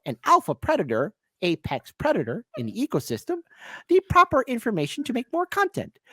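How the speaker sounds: high, swooping puppet frog voice; Kermit the Frog. Acted. Host presents humans as wildlife, high frog puppet impression. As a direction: do a frog puppet reporting from the wild. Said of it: "this is Kermit the Frog here".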